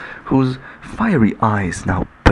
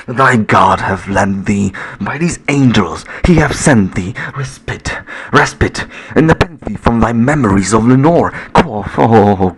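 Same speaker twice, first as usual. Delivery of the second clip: loud, impassioned plea to the bird; a loud anguished cry; 6 dB louder than usual. A narrator's reading. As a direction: call it out with rising force. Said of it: "I cried".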